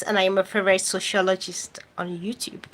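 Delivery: softly whispered boast, tongue in cheek; playful whisper. Real, not acted. To her livestream viewers, a whispered self-introduction. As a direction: whisper it like a little secret.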